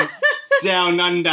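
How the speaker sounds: broad mock Aussie; bad Australian accent. Host carrying on over protests, bad Australian accent. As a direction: lean into clumsy Australian vowels. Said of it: "it's my Australian accent".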